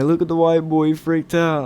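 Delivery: high-pitched teasing imitation; mocking dad. Friend's dad teasing the freaked-out boy, high mocking imitation. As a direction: crow it high, poking fun at the scare.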